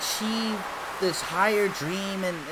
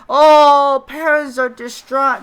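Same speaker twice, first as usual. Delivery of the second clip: mock distraught parent; 7 semitones higher, 11 dB louder, slower than usual. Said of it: "the fob accent".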